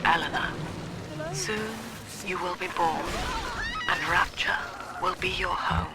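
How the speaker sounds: put-on soft, secret whisper to her child; whispered diary. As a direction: confide to your daughter in a hush. Mother's whispered diary to daughter.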